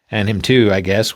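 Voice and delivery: ordinary talk, nothing marked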